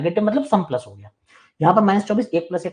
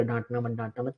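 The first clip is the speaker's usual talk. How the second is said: soft, whiny mimic of a timid student; hushed mock plea, sing-song rise; mocking whine; 4 semitones lower, 10 dB quieter than usual. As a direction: repeat it like a scared kid begging. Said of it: "ये बेवकूफ़ी मत किया कर".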